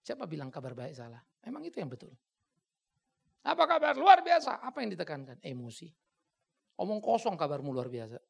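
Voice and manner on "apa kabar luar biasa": high, loud call and answer; mock rally exchange. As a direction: imitate the pumped-up greeting. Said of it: "anak-anak pimpin K K R".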